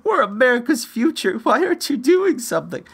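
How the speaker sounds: high, whiny mock of begging teenagers; mocking teens begging the senator; mocking whiny kids. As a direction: squeal it like a panicked kid. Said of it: "and yell and scream and, and beg".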